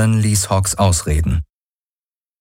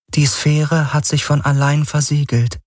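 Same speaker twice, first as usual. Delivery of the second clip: Helix voice; 8 semitones higher than usual. Acted. The Helix explains the breach.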